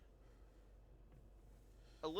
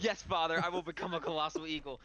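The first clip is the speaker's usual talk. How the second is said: higher, louder hammed-up vow; mock declaration; 5 semitones higher, 4 dB louder than usual. Game master echoing the player's joke, mock-heroic and raised. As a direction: echo it back with playful mock grandeur. GM mockingly echoes player's line.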